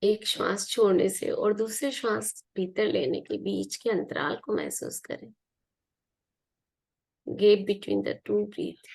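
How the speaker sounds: unhurried meditation guide, long pauses between phrases; guided meditation. Real, not acted. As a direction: guide listeners gently into relaxation, leaving room to breathe.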